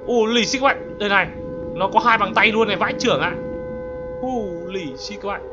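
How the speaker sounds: ordinary talk, nothing marked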